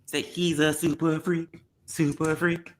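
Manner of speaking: ordinary talk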